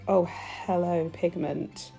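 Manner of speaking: ordinary talk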